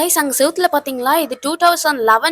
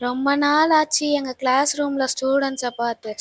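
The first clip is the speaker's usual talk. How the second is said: soft, quiet classroom ghost; eerie ghost voice; 7 dB quieter, slower than usual. Acted. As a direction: greet the children softly, like a haunting spirit. Ghost of old student greets children. (அமானுஷ்யமான பேய்க் குரல்)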